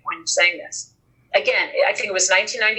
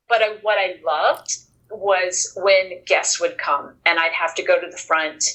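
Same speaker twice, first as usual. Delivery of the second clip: normal talking voice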